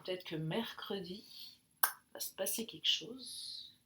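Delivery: hushed, mysterious tease; breathy teaser whisper; suspense whisper. Real, not acted. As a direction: whisper it like a secret. Teasing a prediction for Wednesday.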